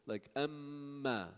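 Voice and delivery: amma with the mm held long through the nose; slow demo of amma with a long humming mm; held nasal mim. Shown as a demonstration. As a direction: stretch the mm in amma slowly. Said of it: "When you hold it".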